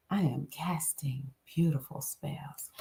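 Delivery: soft breathy whisper. Acted. Reading the spell card to listeners, whispered.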